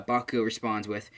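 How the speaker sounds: usual speaking voice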